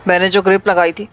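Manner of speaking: deep, cold gangster voice; cold villain. Acted. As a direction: speak down to him, cold and sure. Villain explains sparing him.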